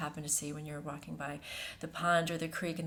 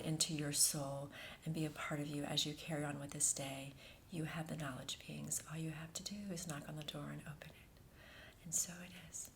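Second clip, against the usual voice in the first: softer, unvoiced fading whisper; 8 dB quieter than usual. Channel closes the meditation, fading softly into a whisper. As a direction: let the voice fade out to nothing.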